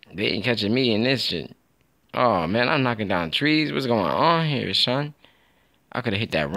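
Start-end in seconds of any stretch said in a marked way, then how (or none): none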